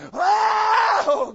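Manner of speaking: loud, high wail to God; mock anguished outcry; anguished cry. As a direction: throw it up like a desperate sinner. Prisoner crying out to God.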